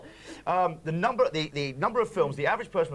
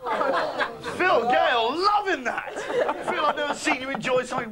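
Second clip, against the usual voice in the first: high, laughing exclamation; amused delight; 8 semitones higher, slower than usual. Real, not acted. Host delighted at colleague's laughing.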